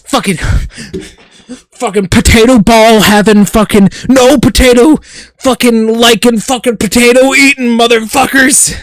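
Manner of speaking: mock furious rant. Streamer ranting at potato-eating chat, mock fury. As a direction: rant like an outraged zealot.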